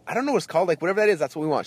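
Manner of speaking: high-pitched, insistent mock frustration; retold exasperation. Customer tries to order a dish he can't name, exasperated. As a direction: re-enact a fed-up order, pitched well up.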